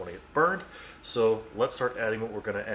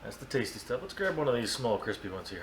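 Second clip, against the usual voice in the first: usual speaking voice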